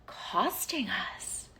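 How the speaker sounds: slow, whispered admission; soft and weighty, nearly all breath; hushed emphasis. Real, not acted. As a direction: drop to a drawn-out whisper. Confides the cost after pause.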